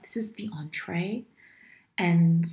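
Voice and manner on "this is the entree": half-whispered joke. Real, not acted. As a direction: whisper it as a playful aside. Whispered correction in banter.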